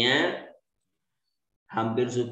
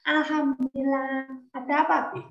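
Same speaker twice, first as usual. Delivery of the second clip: put-on high, quick child's voice; a boy answering his father eagerly; 10 semitones higher, faster than usual. Young Ismail answering his father's call in a high, quick child voice.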